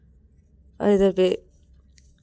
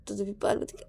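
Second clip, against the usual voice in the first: usual speaking voice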